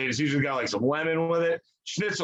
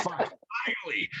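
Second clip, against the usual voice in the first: put-on whispered, slower relieved curse in German accent; mock whisper; 3 semitones higher, 6 dB quieter than usual. [name] finally gets someone to cover for him, a mock whisper. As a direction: breathe it out like a secret relief.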